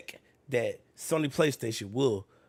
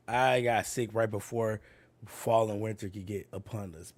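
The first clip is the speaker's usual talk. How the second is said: normal talking voice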